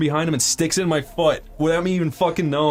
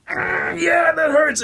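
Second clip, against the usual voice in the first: very high, strained cry of pain; mock agonized wail; 13 semitones higher, much slower than usual. Patient telling doctor it hurts, mock agonized wail. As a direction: wail it high, imitating agony. Retold cry as stick enters toe.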